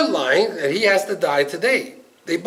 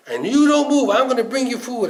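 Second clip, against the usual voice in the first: higher-pitched, eager host; eager insistence; 7 semitones higher than usual. Retold or imitated. Groom fussing over a poor wedding guest, eagerly insistent. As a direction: insist warmly with lifted pitch.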